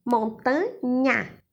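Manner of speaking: Montanha said slowly, syllable by syllable; stretched syllables. Shown as a demonstration. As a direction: draw out Montanha to show the nha. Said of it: "é o nha". (Montanha pronunciada devagar, destacando o nha)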